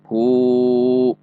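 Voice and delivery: ordinary talk, nothing marked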